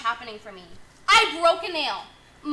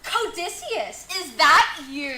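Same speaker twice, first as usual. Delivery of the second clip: Circe voice; 2 semitones higher, 4 dB louder, slower than usual. Acted. Circe calls out.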